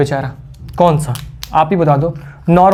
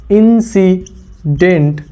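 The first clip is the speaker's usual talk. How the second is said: Incident stretched very slowly; drawn out; much slower than usual. Shown as a demonstration. Teacher spelling the term out for students, very slow. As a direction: drag out incident syllable by syllable. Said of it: "इसे हम लोग प्यार से क्या बोलते हैं?".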